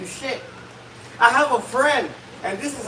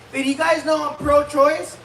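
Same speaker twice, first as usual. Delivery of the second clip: ordinary talk, nothing marked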